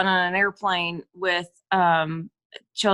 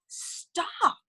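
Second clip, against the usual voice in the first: whispered stop; 9 semitones higher, 8 dB quieter than usual. Retold or imitated. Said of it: "I go, Gabby".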